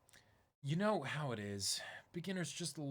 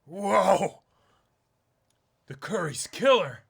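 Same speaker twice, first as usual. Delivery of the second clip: much louder, thrilled over the curry; loud delighted; 6 semitones higher, 13 dB louder, slower than usual. Acted. Player tasting the Palau curry, loud delight. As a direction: wow at the first bite.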